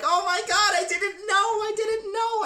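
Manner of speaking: very high mock whine; panicked pleading, imitated. Friend pleading as his mom yells, mock-panicked high voice.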